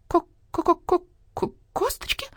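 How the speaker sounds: slow clucking stutter rising very high; clucking stammer. Acted. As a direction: cluck out the word in shock. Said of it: "с трудом выговорила". (кудахтая, с трудом)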